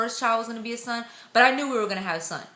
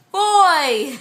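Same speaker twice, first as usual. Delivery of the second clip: loud, high burst on the big news; excited reveal; 6 semitones higher, 7 dB louder than usual. Real, not acted. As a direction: belt out the happy reveal. Big gender reveal announcement.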